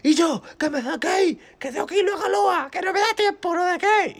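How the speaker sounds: very high, loud mock pleading; yelling to be released. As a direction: holler desperately from the locked room, hammed up. Brother begging to be released.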